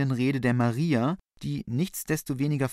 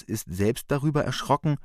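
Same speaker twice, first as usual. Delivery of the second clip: normal talking voice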